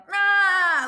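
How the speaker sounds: short high mock yell; imitated crowd yell. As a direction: bark out a fan's rah. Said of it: "someone in the background the entire game going".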